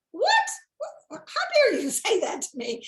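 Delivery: playing up her offended shock; indignant disbelief. Retelling her nephew's jab, mock-indignant disbelief.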